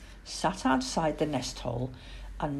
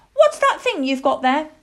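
high, loud little owl voice; piping child-owl curiosity; curious owlet; 10 semitones higher, 8 dB louder than usual. Acted. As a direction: ask nosily as a young owl. Plop curiously questions the man.

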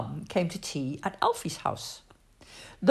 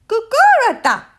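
put-on high, loud baby gibberish; toddler babble; 11 dB louder than usual. A narrator's reading. Annie Rose wanting funny faces, high loud toddler babble. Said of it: "she talked in a private Annie Rose language".

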